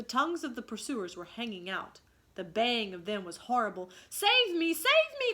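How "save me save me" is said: much higher, loud cries for help; desperate cry. Acted. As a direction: plead for rescue at the top of the voice. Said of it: "cried Nibs".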